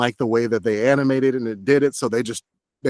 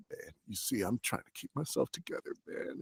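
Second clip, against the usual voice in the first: much softer, holding back tears; held-back sadness; 18 dB quieter than usual. Shown as a demonstration. Actor showing the right way to play grief, soft and held back. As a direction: keep it in, barely above a murmur. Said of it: "it doesn't hit as hard as".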